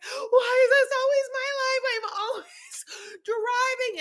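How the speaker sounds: high, slow, sobbing wail with a laugh; crying despair. Retold or imitated. Wife breaking down behind the wheel, crying wail. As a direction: wail in tearful exasperation. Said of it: "Monique again is like".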